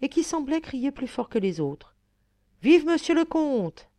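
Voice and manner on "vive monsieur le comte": cri haut et lent, voix changée; acclamation de foule. A narrator's reading. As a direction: acclamer le comte à pleine voix. (acclamation lente et aiguë)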